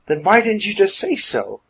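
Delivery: exasperated. A narrator's reading. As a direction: ask it as an exasperated complaint. Woman exasperated at wasted language attempts.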